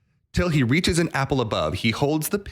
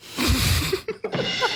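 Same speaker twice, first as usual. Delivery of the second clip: big laugh. Real, not acted. Laughing at own punchline.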